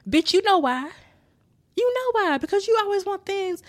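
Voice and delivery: high-pitched, mock-indignant comeback; exasperated retort. Daughter answering her mother's silly question, exasperated. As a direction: throw it back high and irritated.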